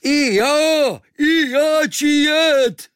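very high, loud, stretched-out foreigner's holler; joining in shouting. A narrator's reading. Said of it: "A Kvíkvék se hned přidal". (nadšený, táhlý výkřik cizince)

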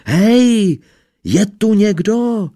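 high, loud, slow shout into the empty house. Acted. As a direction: yell as loud as he can. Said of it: "naposled se rozkřikl".

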